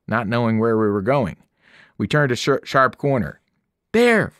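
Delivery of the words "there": loud high shout. A narrator's reading. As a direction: call out the spotted shelter. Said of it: "Annabeth shouted".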